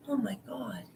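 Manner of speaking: breathless whispered aside; real hushed exasperated whisper. Real, not acted. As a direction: whisper it to yourself, almost unvoiced.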